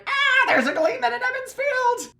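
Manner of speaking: very high fangirl squeal; excited squeal. Retold or imitated. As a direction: squeal like thrilled kids spotting a star. Villagers' excitement acted out.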